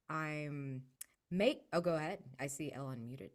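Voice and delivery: ordinary delivery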